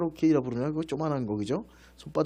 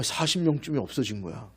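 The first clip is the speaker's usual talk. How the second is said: normal talking voice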